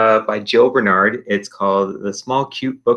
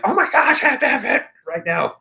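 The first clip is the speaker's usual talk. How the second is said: mock excitement; 13 semitones higher, faster than usual. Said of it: "but you're not like".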